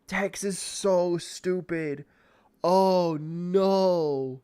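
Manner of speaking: drawn-out, high "so stupid"; slow lament. Real, not acted. Gamer lamenting his dim character, slow and drawn out. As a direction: stretch every word in despair.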